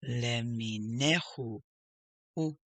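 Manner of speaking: LE-MI-NE-HU run together; joined-up syllables, hu echoed at the end; blended word. Shown as a demonstration. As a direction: link le, mi, ne, hu in one go.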